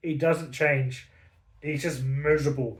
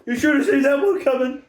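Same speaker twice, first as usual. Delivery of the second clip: cackling, jeering book voice, much higher and louder; gleeful sneering taunt, the stupid fuck line slowed; mocking taunt; 9 semitones higher, 5 dB louder, slower than usual. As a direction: laugh at them like a cruel twist ending. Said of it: "and then just goes".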